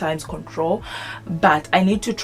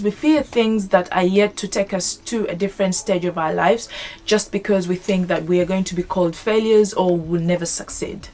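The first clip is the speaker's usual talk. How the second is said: normal talking voice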